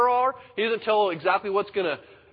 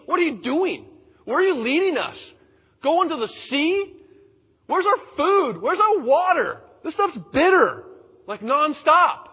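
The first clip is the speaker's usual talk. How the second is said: high, louder nagging questions; complaining crowd; 9 semitones higher, 6 dB louder than usual. Acted. The people nag Moses in the wilderness, in a high loud whine. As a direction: pile on the complaints, higher and louder.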